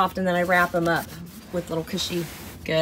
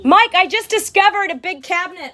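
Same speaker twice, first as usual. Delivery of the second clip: excited call; 9 semitones higher, 5 dB louder than usual. Real, not acted. Calling excitedly to husband.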